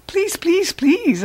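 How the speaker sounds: high small begging squeak; squeaky pleading. A narrator's reading. Hamster begging the janitor to stay, squeaky and high. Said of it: "I squeaked".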